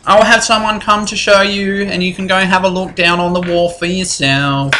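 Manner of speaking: a fussy clerk who finds everything filthy; lightly voiced old clerk.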